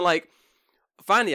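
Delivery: usual speaking voice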